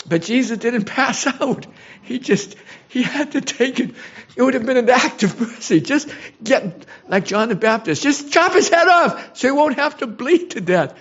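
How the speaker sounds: high-pitched, impassioned distress; emotional. Real, not acted. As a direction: overcome with real emotion, voice rising high. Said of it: "Forgive me for getting emotional".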